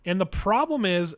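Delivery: normal talking voice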